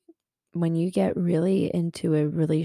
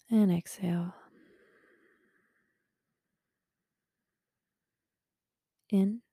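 guided breathing; 2 semitones higher than usual. Acted. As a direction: guide the listener's breath gently. Leads breathing exercise.

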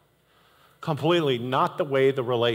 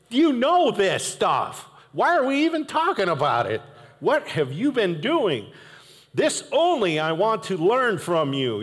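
exasperated rant; 6 semitones higher than usual. Retold or imitated. Paul letting the Galatian churches have it, exasperated. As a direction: let them have it, fed up. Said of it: "He's like".

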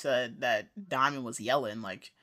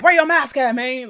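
much higher and louder hammed-up bark; mock security guard yell; 8 semitones higher, 10 dB louder than usual. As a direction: yell at a customer about the mask. Voicing the security guard yelling.

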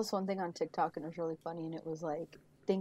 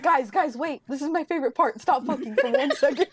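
higher, louder mock plea for attention; acted urgent interruption; 6 semitones higher, 6 dB louder than usual. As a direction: cut in loudly, playing the exasperated viewer. Acting out annoyed friend interrupting.

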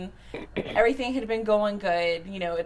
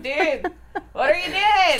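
laughing, half-crying mock plea, higher and louder; mock panicked cry; 9 semitones higher, 6 dB louder than usual. To the sleeping Jesus, a laughing, half-crying mock plea. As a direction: plead through laughter as if panicking.

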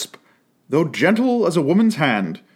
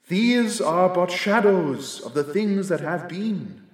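softer, higher, musing spirit; quiet ghostly spirit; 4 semitones higher, 4 dB quieter than usual. A narrator's reading. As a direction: observe it gently like a ghost. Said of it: "said the ghost".

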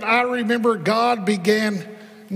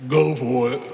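low, cocky New York tough-guy voice; a Rocky-style New York accent, dropped low and defiant; 8 semitones lower than usual. Retold or imitated. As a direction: answer in a lightly done New York accent. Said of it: "in his New York accent".

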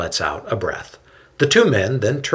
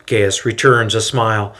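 ordinary delivery, nothing marked

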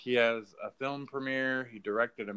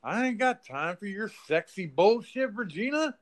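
high-pitched mock thug voice, much higher; hammed-up goon line, high; imitating the henchman; 9 semitones higher than usual. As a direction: toss it off as a dumb goon, pitched way up. Retelling henchman's line in movie.

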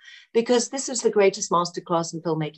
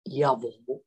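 put-on low German-accented reply; retold German-accent try-out, pitched down; 6 semitones lower, 4 dB quieter than usual. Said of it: "How's your German accent?".

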